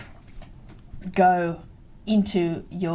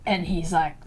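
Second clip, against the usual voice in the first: normal talking voice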